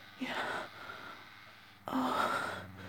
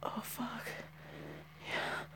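soft aroused whisper; 2 semitones lower, 11 dB quieter, slower than usual. Real, not acted. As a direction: breathe the words out quietly.

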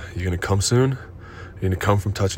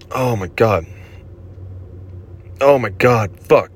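higher and louder, overcome; loud outburst; 5 semitones higher, 4 dB louder, slower than usual. Acted. Lover losing control at the climax, loud outburst. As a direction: let it burst out at the peak.

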